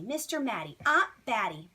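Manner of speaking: ordinary delivery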